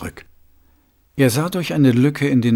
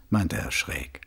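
plain reading voice

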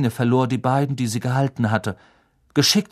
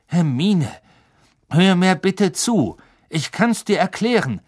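urgent pleading; 8 semitones higher, 5 dB louder than usual. A narrator's reading. Lupin fleht Hermine an.

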